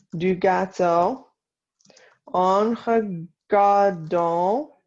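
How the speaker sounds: slow French in short separated chunks; slow dictation. Shown as a demonstration. Teacher to class copying the tea and cake sentence, slow dictation. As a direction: dictate phrase by phrase with long pauses. Dictation, later repeated at speed.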